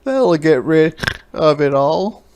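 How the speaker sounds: loud snorty pig voice; snorting pig. A narrator's reading. Pig's voice.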